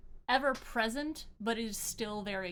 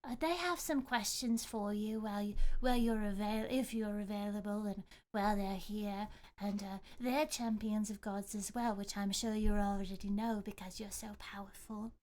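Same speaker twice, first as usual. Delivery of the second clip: flattering goddess. Acted. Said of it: "she says".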